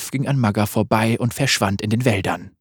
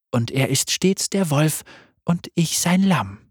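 deutlich höher, sanft und verspielt; helle Lamm-Stimme; 7 semitones higher than usual. Acted. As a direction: ein unheimlich freundliches Lamm. Lam speaks, high voice. (Lamm stellt sich vor, hoch und sanft)

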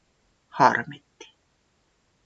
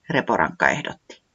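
plain reading voice

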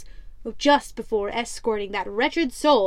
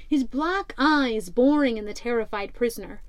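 usual speaking voice

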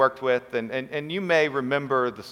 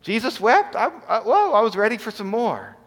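higher, mock startled disbelief; mock surprised congregation; 7 semitones higher, 3 dB louder than usual. Pastor mimicking his congregation's surprise at the short reading, high and incredulous. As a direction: play the stunned listener wanting more. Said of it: "and you're like".

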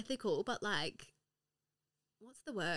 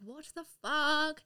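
slow, higher, stunned "What the fuck?"; amazed disbelief; 7 semitones higher, 3 dB louder, slower than usual. Retold or imitated. Podcaster retelling how the universe delivers, stunned. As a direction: draw it out in wide-eyed disbelief. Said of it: "and I'm like".

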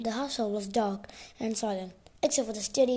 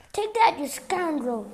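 raised, higher-pitched scolding cry; narrator's restrained version of a shriek; mild shriek; 5 semitones higher, 6 dB louder than usual. A narrator's reading. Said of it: "they heard Aunt Gertrude shriek".

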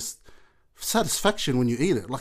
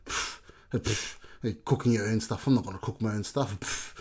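soft scoffing, lower and quieter; dismissive scoff; 6 semitones lower, 8 dB quieter than usual. Retold or imitated. Doubting Korean barbecue, soft low scoff. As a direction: brush it off with a scoff. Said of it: "I was like".

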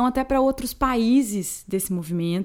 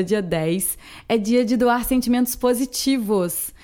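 ordinary delivery

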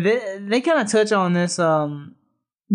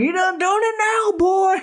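much higher, put-on yelling country cook; 13 semitones higher, slower than usual. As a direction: scold him like a furious hillbilly cook. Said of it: "He's over there yelling".

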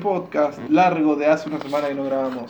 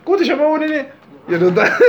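loud, high-pitched mock demand; mock narco threat; 11 semitones higher, 5 dB louder than usual. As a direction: demand it like a hammed-up drug boss. Finge ser narco que toma nombres.

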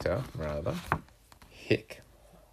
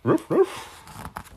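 high, loud yappy bark; sharp double woof; dog bark; 7 dB louder than usual. Shown as a demonstration. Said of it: "Can you bark like a dog?".

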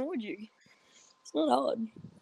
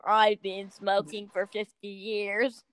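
slow, low lifelong smoker; drawn-out, lowered smoker impression; smoking-ad voice; 4 semitones lower, much slower than usual. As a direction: imitate a veteran smoker from an ad. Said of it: "one of the people in the smoking ads".

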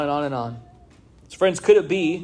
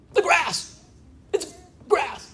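high, hammed-up panic at the grass; playful pretend terror, much higher; mock fright; slower than usual. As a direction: squeal like the lawn is attacking you. Mock fear of the lawn.

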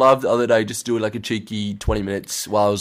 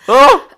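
gasp; 14 dB louder than usual. Real, not acted. Startled gasp at the story.

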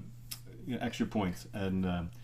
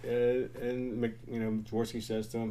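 usual speaking voice

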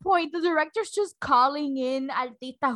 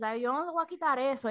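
normal talking voice

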